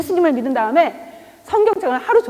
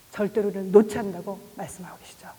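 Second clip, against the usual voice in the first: whispered; 5 semitones lower, 5 dB quieter than usual. Acted. Tender closing whisper.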